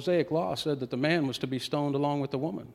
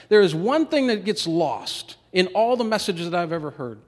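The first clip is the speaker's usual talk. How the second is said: preaching at full force; 5 semitones higher, 5 dB louder than usual. Acted.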